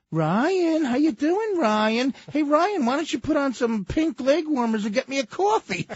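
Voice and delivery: high teasing voice; mocking taunt. [name] mocking Ryan between takes, high teasing. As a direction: tease him up high.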